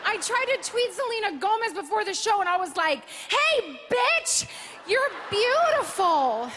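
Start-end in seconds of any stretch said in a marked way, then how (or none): 3.1-6.5 high-pitched, playful gushing call; exuberant gushing. Retold or imitated. Host reading her tweet to a pop star, playfully gushing. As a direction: call it out high, then stretch the compliment.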